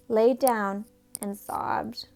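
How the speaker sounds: plain reading voice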